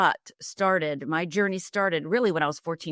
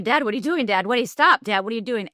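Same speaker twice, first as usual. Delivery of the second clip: raised, urgent cry to dad; retold screaming; 4 semitones higher, 5 dB louder, faster than usual. Daughter begging her father to stop, retold scream. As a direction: plead loudly with dad to stop, part-way to a scream. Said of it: "And I start screaming".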